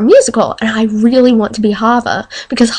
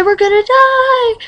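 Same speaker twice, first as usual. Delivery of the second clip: repeated higher, mock wailing; higher panic; 14 semitones higher than usual. Girl acting out her own tornado panic, in a higher mock wail. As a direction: ramp the dread up a notch.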